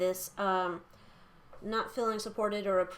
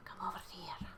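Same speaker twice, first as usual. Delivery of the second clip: hushed coaxing; 10 dB quieter than usual. Real, not acted. Coaxing a card aside.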